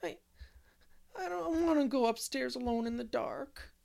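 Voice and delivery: scared of the dark, pleading through tears; sobbing little girl. Acted. Dora begging not to go upstairs, tearful and halting. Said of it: "she sobbed".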